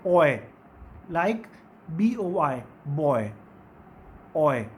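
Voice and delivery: ओए and बॉय low, aw gliding to ee; teacher modelling "boy"; diphthong demo. Shown as a demonstration. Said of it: "इसको आप कैसे पढ़ते हैं".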